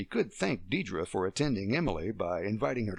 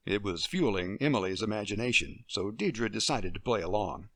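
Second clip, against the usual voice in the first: ordinary delivery, nothing marked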